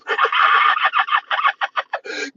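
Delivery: long high-pitched cackle; high cackling laugh. Real, not acted. Man amused by his crazy friends, high cackling laugh. Cracks up at own remark.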